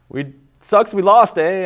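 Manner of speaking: hammed-up Canadian accent, high and loud; bad put-on Canadian accent. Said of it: "I can't do Canadian accents".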